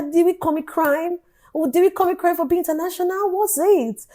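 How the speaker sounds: high-pitched indignant questioning; hammed-up wronged complaint; mock protest. Acted protest against residency rule.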